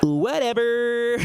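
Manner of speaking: high, long-drawn mock whatever; dismissive comeback. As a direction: drag it out high like a sulking kid. Acted sulky comeback to scolding.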